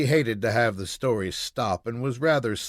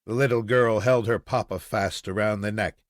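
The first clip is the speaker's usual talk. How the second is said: ordinary delivery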